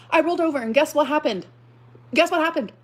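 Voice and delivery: usual speaking voice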